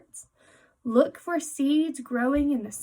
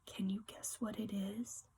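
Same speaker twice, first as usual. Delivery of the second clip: whispered question; 4 semitones lower, 15 dB quieter than usual. Acted. Teacher reading aloud, teasing question.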